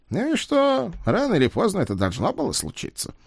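offhand. Acted. Ed shrugging it off.

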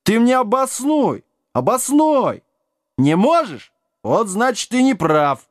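high-pitched indignant arguing; offended challenge. A narrator's reading. Giant demands Sam justify himself, indignant.

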